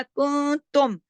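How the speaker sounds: slow, drawn-out Quran recitation; chanting the verse as a teacher's model; tajweed recitation. Shown as a demonstration. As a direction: recite it slowly with careful tajweed. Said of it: "तुम पे मैंने इजहार किया".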